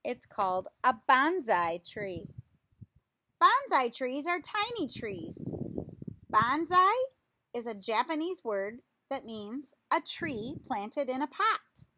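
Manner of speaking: slow, lively picture-book reading voice; reading to children. Acted. Reading a tree picture book to children, lively storytime voice. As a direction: read it out like a storytime for little kids.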